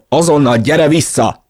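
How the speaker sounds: loud, high, angry order; yelling. A narrator's reading. Narrator orders sister back, yelling. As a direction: shout a demand through a door. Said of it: "Üvöltöttem".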